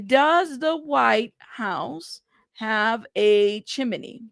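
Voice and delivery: very slow, drawn-out words; slow, spaced-out search query; saying it while typing. Real, not acted. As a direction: read it out word by word as you type.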